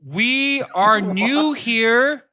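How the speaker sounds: very slow, loud, high over-enunciation; slow talking down. Retold or imitated. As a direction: talk slowly like to a foreigner. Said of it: "talk to you really slow".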